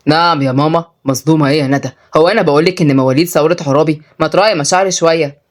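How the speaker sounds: low male character voice; mock-offended young man. Tutor protests her teasing, low and mock-offended. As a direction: drop low and protest playfully.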